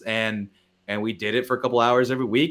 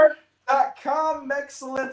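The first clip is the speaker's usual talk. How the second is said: high pitchman tag line; ad announcer voice; 11 semitones higher than usual. Acted. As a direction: announce the site name. Mock ad continues.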